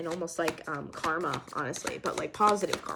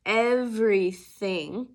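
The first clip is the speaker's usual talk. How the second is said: EVERYTHING loud and drawn out; emphatic stress; 2 semitones higher, 8 dB louder, much slower than usual. Real, not acted. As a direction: hit "Everything" hard and stretch it slowly. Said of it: "Can't stress it enough".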